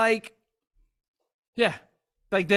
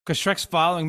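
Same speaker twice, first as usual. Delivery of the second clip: ordinary talk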